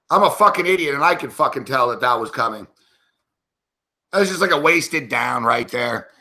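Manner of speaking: ordinary talk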